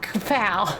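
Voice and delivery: put-on Brooklyn accent. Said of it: "Brooklyn accent".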